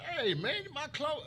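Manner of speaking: high, loud mock complaint from a buyer; upset customer. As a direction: play the angry customer calling him out. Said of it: "somebody be like".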